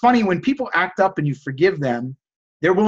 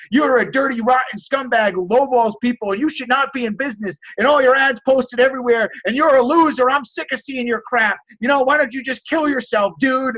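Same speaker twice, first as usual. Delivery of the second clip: raised, higher-pitched ranting insults; angry caller; 7 semitones higher than usual. Retold or imitated. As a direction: imitate an irate customer tearing into you. Imitates abusive caller's rant.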